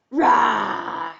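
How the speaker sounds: big rough lion's roar. Pretend. Teacher shows children the story cue, big lion roar. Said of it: "when you hear lion".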